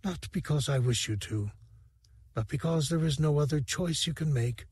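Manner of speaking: a fading alien rebel calmly letting her beloved go; dying alien lover, tender. A narrator's reading. Dice's last words to Sivrak.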